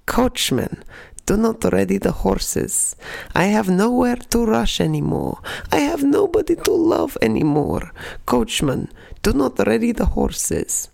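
put-on a grandmother translating a song in her thick Russian accent. Granny translating the chorus for her grandchild, thick Russian accent. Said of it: "In her thick Russian accent".

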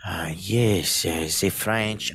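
put-on not very good French accent; bad French accent. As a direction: try out a French accent, musingly. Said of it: "I'm not very good at French".

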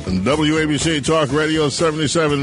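ordinary delivery

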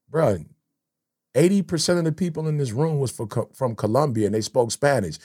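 gently reprove, low and hushed; God's retold reproof. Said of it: "and God was like".

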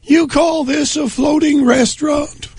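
very high, slow falsetto impression; comically high-pitched mock monarch, indignant; Queen Elizabeth. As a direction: put on a squeaky, drawn-out royal voice. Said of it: "I guess the Queen weighed in".

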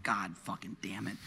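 low, soft resigned swearing; deflated mock cursing under the breath; muted exasperation. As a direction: curse quietly to yourself, slowly. Exasperated reply retold under breath.

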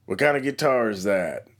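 put-on quoting the old Southern gentleman; imitating a Southern accent. Airport veteran asks about the guitar, light Southern accent. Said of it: "a gentleman with a southern accent".

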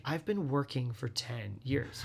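deliberate, understated exasperation; pointed retort. Retold or imitated. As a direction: spell it out slowly and quietly. Retold weary retort to the surprised.

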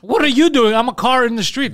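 mock yell. Mocking the skater's yell.

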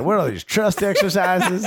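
high, slowed mock Jersey accent; bewildered old salesman with Jersey vowels; Bob Bradley. Coach scoffing at holding hands, high slow Jersey accent. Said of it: "He's like".

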